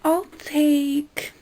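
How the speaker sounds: hammed-up customer dithering over an order; imitating dithering customer. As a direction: hold the words while pretending to choose. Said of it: "and they go".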